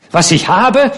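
high, loud outburst; shouting. A narrator's reading. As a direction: finally snap back with a shout. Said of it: "schrie sie endlich". (laut geschrien, hoch und gereizt)

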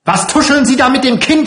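very high, loud, rapid shriek; furious screaming. Acted. Matron catches whispering with the child, furious shriek. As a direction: scream the accusation at top pitch. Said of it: "schrie die Frau".

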